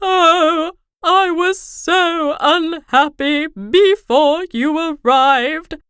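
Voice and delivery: king crying about being laughed at, slow wail. Acted. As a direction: wail it like a big baby. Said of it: "he wailed".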